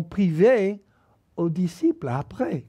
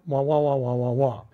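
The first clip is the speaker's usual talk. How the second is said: muffled wah wah talk; 8 semitones lower than usual. Retold or imitated. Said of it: "Donc les enfants".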